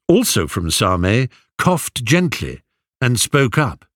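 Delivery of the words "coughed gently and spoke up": ordinary delivery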